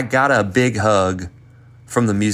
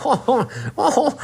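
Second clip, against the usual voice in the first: mocking laughing imitation; 13 semitones higher than usual. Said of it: "we'd be like".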